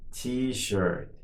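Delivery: drawn-out word for learners; slow model. Shown as a demonstration. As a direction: say it slowly and evenly for repetition. Teacher models word for repetition.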